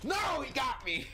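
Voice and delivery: high shouted dismay. Real, not acted. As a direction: cry out at getting caught. Killed in game.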